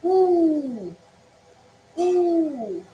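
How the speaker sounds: long rounded "oo" held out; teacher demonstrating "oo"; vowel oo demo. Shown as a demonstration. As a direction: model the "oo" vowel slowly for learners. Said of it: "This is".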